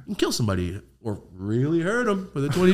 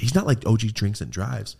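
ordinary delivery

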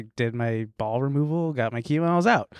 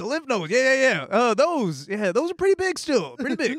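higher, laid-back bro doctor; lazy bro doctor voice; frat bro; 9 semitones higher than usual. Retold or imitated. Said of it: "what the guy sounded like".

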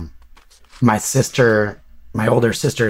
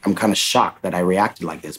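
ordinary talk

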